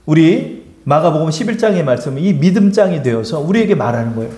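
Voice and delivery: normal talking voice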